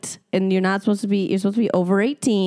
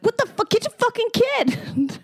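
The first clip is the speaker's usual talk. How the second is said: outraged yell. Retold or imitated. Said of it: "and I was like".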